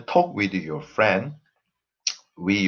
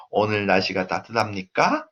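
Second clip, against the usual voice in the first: "따뜻합니까" climbs at the end; rising intonation; much faster than usual. Shown as a demonstration. Teacher demonstrates Korean question intonation, rising on "따뜻합니까". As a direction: lift the last syllable of "따뜻합니까". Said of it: "The intonation goes up".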